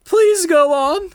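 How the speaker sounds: high, slow breathless damsel; hammy stage actor. Acted. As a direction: beg him to go on, swooning. Damsel greets her knight.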